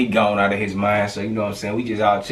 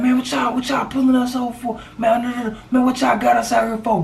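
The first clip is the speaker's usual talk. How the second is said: much higher, squeaky mock protest; imitating angry friend; 13 semitones higher than usual. Friend snapping at police, high mock protest. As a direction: ham up a whiny complaint.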